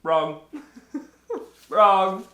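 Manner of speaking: laughing through the word; teasing laugh on a single word. Real, not acted. Teasing quiz answer.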